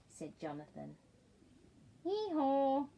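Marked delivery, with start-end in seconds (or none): 2.0-2.9 louder, higher hee-haw bray; mock donkey bray. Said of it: "brayed the donkey".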